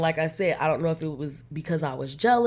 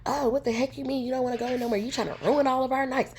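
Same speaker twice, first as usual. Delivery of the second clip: high, fast mock outburst; imitating angry friend; 7 semitones higher, faster than usual. Imitating best friend flipping out at her, fast and high. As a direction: rattle it off like the upset friend. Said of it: "she starts slipping out".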